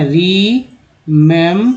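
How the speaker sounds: "रीमेंबर" drawn out slowly, each syllable clear; slow pronunciation demo. Shown as a demonstration. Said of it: "अब इसका उच्चारण तो ये हो गया ना". ("रीमेंबर" धीरे-धीरे साफ़ उच्चारण)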